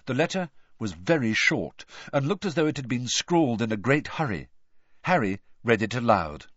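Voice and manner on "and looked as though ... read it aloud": plain reading voice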